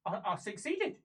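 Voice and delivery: hesitant, rising disbelief; dawning realisation. Acted. Prisoner just told her target is dead, hesitant realisation. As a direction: stumble into it as it sinks in.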